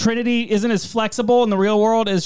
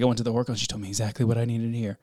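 low, fast put-on voice; mock-solemn low voice; Trinity impression; 7 semitones lower, 5 dB quieter, much faster than usual. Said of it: "is like".

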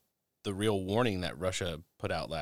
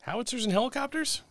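incredulous. Real, not acted. Mocking the news list.

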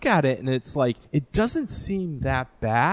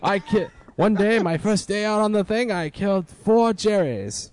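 put-on boastful old soldier voice; 1940s announcer voice; 6 semitones higher than usual. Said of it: "You're getting back into nineteen forties, uh, announcer guy".

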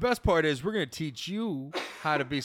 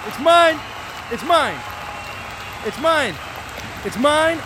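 shouted call; 8 semitones higher, 5 dB louder than usual. Acted. Repeated quarterback shouts.

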